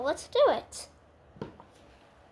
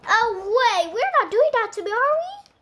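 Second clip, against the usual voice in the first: fast, rising, louder alarmed question; worried protest; 5 semitones higher, 6 dB louder than usual. Pretend. Butterfly puppet asking the others if they'll execute it, fast rising alarmed squeal. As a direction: squeak it out, scared they mean you.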